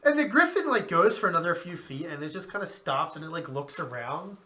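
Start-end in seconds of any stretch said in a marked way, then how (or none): 0.0-0.7 high laugh. Real, not acted.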